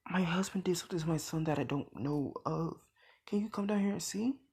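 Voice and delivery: fast, hushed whispered phone call; urgent whisper. Acted. Inko calling the police, fast urgent whisper. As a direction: whisper quickly so he won't hear. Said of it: "Inko calls the police saying".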